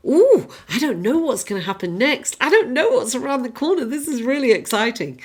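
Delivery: lively, lifted, wide-eyed anticipation; eager excitement. Acted. The Fool facing what happens next, eager excitement. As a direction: relish the unknown like a naive adventurer. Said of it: "Fool is like".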